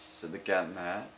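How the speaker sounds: ordinary delivery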